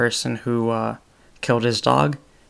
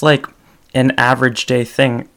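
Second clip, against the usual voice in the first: normal talking voice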